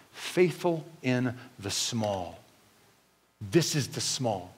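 low, soft and slow; hushed emphasis. Real, not acted. Preacher recalling the lesson for the congregation, hushed. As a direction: let each word land slowly, very quietly. Preacher drops to reflective point.

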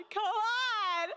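pleading through tears; real crying. Real, not acted. Overcome by emotion after a sigh.

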